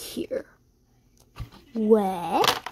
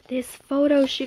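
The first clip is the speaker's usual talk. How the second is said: ordinary talk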